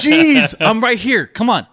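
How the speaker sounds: loud, high-pitched jokey yell; hammed-up impatient shout; mock exasperation. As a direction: holler back as if fed up, half laughing. Mock exasperated yelling at the episode.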